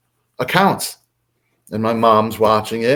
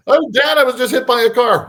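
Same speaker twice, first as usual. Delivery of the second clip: high, loud mock plea to Dad; lightly voiced sitcom son calling out to his father; sitcom kid; 12 semitones higher, 4 dB louder, faster than usual.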